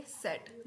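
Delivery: German Z named सेट, starting with s; German letter Z. Shown as a demonstration. Teacher showing German Z, सेट as "set". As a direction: say सेट as "set", hard final t. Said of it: "जेड को बोलेंगे".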